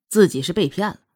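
usual speaking voice

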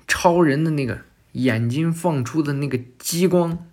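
ordinary delivery, nothing marked

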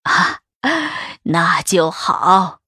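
put-on slow, low, satisfied old matriarch; pleased old lady. A narrator's reading. Old mistress holds the concubine's hand, pleased and slow. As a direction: draw it out with an old woman's satisfaction. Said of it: "拉着茯苓的手笑道".

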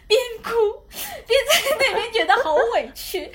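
high, loud, tearful laugh; laughing through tears. Real, not acted. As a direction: let the laugh crack into a sob. (又哭又笑地说)

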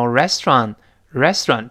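"restaurant" squeezed to "res-tron"; fast reduced form. Shown as a demonstration. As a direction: swallow the middle vowel and final t of "restaurant". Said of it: "读快之后呢".

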